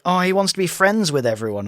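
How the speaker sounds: high, quick sarcastic mimicry; passive-aggressive. Woman mimicking a partner's gripe about him, passive-aggressive. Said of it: "she's being passive-aggressive and sarcastic".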